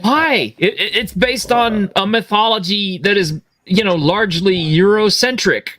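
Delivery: high-pitched indignant rant. Real, not acted. Outraged fan rant.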